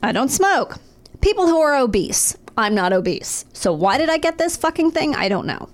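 ordinary talk

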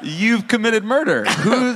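high, slow, hammed-up accusing cry; mock accusation. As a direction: accuse in overblown mock horror.

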